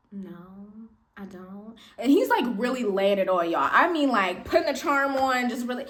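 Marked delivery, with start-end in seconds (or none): 0.1-1.8 quiet flat no. Retold or imitated. Quoting her own refusal.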